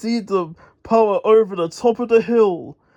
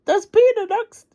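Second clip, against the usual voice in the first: alarmed yell; 13 semitones higher than usual. Acted. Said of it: "yelled Muck".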